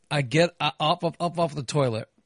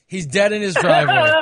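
laughing; 5 semitones higher, 6 dB louder than usual. Retold or imitated. Imagined neighbors, laughing.

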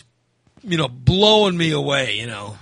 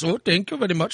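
higher, polite mock Indian accent; imitated Indian accent; 4 semitones higher than usual. Indian students thanking their driver, polite imitated Indian accent. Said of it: "that are from India".

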